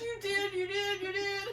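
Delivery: high, loud wailed accusation; imitated crying. Daughter accusing mom on phone, crying. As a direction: mimic the daughter's tearful insistence. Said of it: "then she starts crying".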